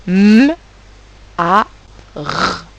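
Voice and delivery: "M, A, R" as three isolated sounds, not letter names; hummed M, then A, then rasped R; separate sounds. Shown as a demonstration. Teacher checking three circles, "M, A, R" sounded out singly. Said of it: "il fallait faire trois ronds".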